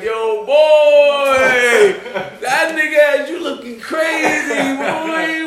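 high, loud imitated shout; mock astonished holler. Friend reacting to the shaken host, imitated astonished shout.

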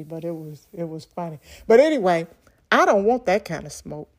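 normal talking voice

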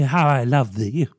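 ordinary talk, nothing marked

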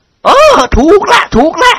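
high, drawn-out confirmation; eager agreement. Acted. Lady delightedly confirms the answer.

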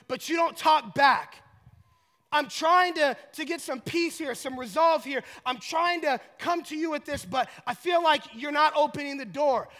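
loud, high-pitched complaint to God; impassioned acted prayer of exasperation; frustrated plea. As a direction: plead urgently, leaning hard on key words. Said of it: "put that in layman's terms".

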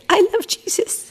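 high whispered little sheep. Pretend. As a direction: whisper it like a tiny lamb. Parent voices the sheep.

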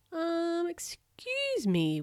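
high, drawn-out timid query; polite, high-pitched attempt at getting attention; tentative whisper, voiced. A narrator's reading. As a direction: stretch it out high and hesitant. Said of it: "whispered the medic".

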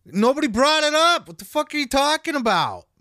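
loud incredulous rant; exasperated outburst. Real, not acted. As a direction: blurt it out in disbelief.

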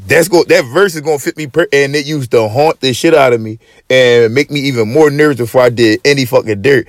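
usual speaking voice

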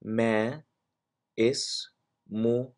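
syllable by syllable, اسمك ending "ki"; long pauses for the learner, final "ee" in اسمك; very slow demonstration. Shown as a demonstration. Teacher drilling the question to a woman, very slowly, اسمك ending "ki". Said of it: "say them very slowly and repeat".

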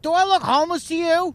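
furious, insulted outburst; angry retort. Retold or imitated. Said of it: "He's like / he was mad".

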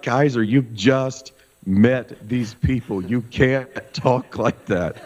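mock scolding, slow, laughing through it; a parent's scolding that can't keep a straight face; scolding through laughter. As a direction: tell the kid off while cracking up. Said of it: "I was dying laughing".

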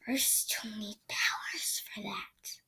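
slow, tired whisper; whispering tree. Acted. Wounded Hearth Star whispering to the children. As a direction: whisper it wearily. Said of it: "whispering vibe".

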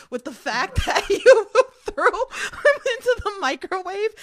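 words broken by laughter, slow and high; laughing. Real, not acted. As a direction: laugh through the question. Host laughing while asking.

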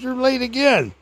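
high mock scolding preacher; teasing preacher. Preacher calling from his car window, high mock scolding. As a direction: rib him like a joking preacher.

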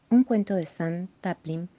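usual speaking voice

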